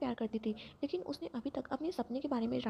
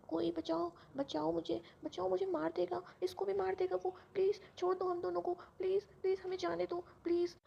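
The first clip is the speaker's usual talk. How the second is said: high, frightened cries for help, dropping to a breathless whisper; terrified pleading in a nightmare; 7 semitones higher than usual. Acted. Girl in a nightmare begging her attacker, high terrified pleading. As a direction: beg for your life in a nightmare. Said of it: "ज़ोर ज़ोर से चिल्लाती है".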